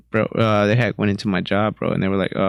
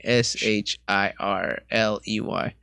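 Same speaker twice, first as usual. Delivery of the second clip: ordinary talk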